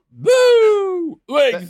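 high, loud mocking "Boo! Wings"; hammed-up wrestler whoop; mock Flair woo.

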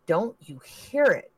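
scared. Acted. Cheryl scared, listening.

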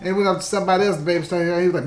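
usual speaking voice

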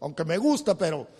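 ordinary talk

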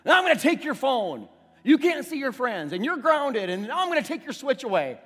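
strict father reeling off punishments; stern punishing dad. Retold or imitated. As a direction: lay down the law on your son.

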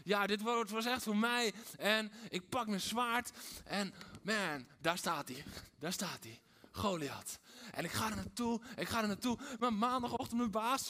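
mock-heroic churchgoer pumping himself up; Sunday bravado. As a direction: swagger in like a hero with a sword. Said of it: "Dat we denken".

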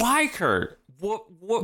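high mock exasperation; exasperated Wanda. Wanda questioning Kurt, a high exasperated mock plea. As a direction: beg for a reason, fed up. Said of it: "she's just like".

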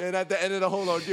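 ordinary talk, nothing marked

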